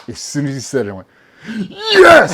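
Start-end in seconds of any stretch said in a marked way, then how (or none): 1.7-2.3 very high, loud victorious scream; screamed triumph. Retold or imitated. Trainee drafted to Red squadron, screaming triumph. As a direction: explode with joy on one word. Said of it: "I fucking screamed".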